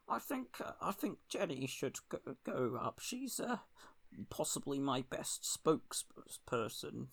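second try at a hesitant, hushed politician; Keir Starmer. Acted. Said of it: "That's not a good Keir impression".

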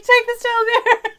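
high, loud laughing command; laughing mock order. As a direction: blurt it out while laughing. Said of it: "and it's like".

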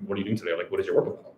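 ordinary talk, nothing marked

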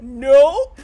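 loud blurted nope; mock nope. Host replaying Izzy's line she loves, loud blurted nope. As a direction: bark out the refusal. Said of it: "I love the way she says it".

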